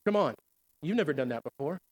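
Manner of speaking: low, soft, taunting whisper of the tempter; preacher voicing the devil's soft low taunt; the enemy's taunting voice. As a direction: drop low and quiet like the devil whispering doubt. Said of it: "He says".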